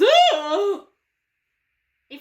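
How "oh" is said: loud laughing gasp of surprise; startled Moses. Acted. Moses hearing God from the bush, startled cry. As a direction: jump out of your skin. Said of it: "Moses goes".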